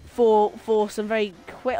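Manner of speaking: ordinary delivery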